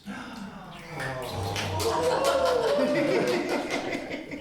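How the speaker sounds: a delighted gasp at the kiss. Acted. Narrator gasping as Prince and Ella kiss.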